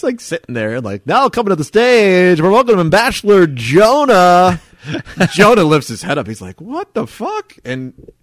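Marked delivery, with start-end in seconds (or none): 1.1-4.6 loud, higher stage introduction; DJ announcer. Acted. Imitates club DJ announcing stage act. 6.6-7.6 high-pitched, rising shock; retold baffled outburst; startled disbelief. Jonah startled at being called up.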